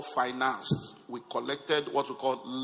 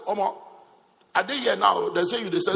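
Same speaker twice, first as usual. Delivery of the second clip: higher, louder Pidgin exclamation; excited call; 5 semitones higher, 6 dB louder than usual. Retold or imitated. Phoning his friend about the screens, excited and louder. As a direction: call out eagerly, voice up.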